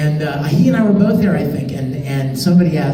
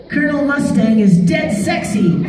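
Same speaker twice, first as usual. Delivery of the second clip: put-on much higher boyish voice, laughing; Al's famous line; 9 semitones higher than usual. Shown as a demonstration. Performing the forbidden line for fans, high boyish voice. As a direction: deliver the catchphrase with a giggle. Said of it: "I'm gonna tell you something".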